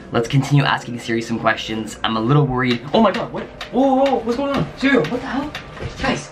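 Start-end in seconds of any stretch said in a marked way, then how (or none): 2.9-6.3 much higher, panicked burst; shocked shriek. Real, not acted. Siri suddenly doing something creepy, shocked high-pitched shriek. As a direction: yelp in real alarm.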